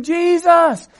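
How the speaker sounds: high, loud beggar's cry; screaming. Acted. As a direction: cry out over the crowd. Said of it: "these poor beggars are screaming".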